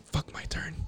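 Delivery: hushed, voiceless acted brush-off; very soft, spent whisper; whispered stoned refusal. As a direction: whisper it with no energy at all.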